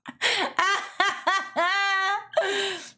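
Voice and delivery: long, high burst of laughter; high cackling laugh. Real, not acted. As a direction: cackle at your own silly joke. Laughing at own meat juice quote.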